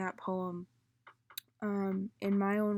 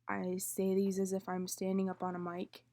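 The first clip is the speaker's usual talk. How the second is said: usual speaking voice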